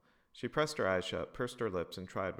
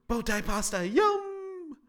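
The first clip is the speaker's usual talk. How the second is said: put-on high loud child's cheer; squealing loud kid voice; little girl roaring; 10 dB louder, slower than usual. A narrator's reading. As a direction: roar it like a thrilled four-year-old. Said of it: "Loretta roared".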